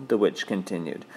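plain reading voice

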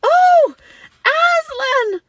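very high, loud exclamation; amazed cry. A narrator's reading. Children see the lion alive, amazed high cry. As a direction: cry out, half frightened and half glad. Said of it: "cried both the children".

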